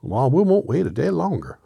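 high, loud, indignant character voice; Scarecrow. Acted. Straw man refusing to wait, high indignant voice. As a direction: protest loudly in a high-pitched voice. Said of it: "said the Scarecrow".

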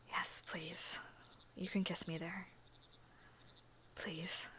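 soft whispered please; hushed aroused plea; breathy pleading. Acted. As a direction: beg quietly.

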